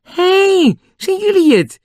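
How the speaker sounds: loud, high, surprised shout; high yell across a distance; shouting back. A narrator's reading. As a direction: shout back in happy recognition. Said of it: "Toen schreeuwde hij terug".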